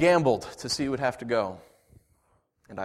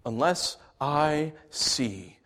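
building vow; 8 semitones higher, 8 dB louder, much slower than usual. Acted. Thomas vowing to John, slow build to shouting. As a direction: build slowly to a furious oath.

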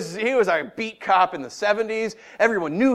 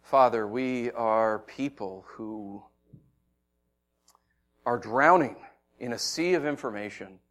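quiet pastoral prayer; 7 semitones lower, 3 dB quieter than usual. Acted. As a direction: pray humbly for the congregation. Leads a closing prayer.

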